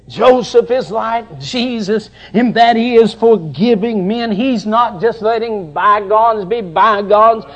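ordinary talk

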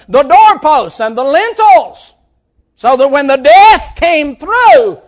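loud preacher's build; preaching. Acted. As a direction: tell the Passover story with force. Preaching at full force.

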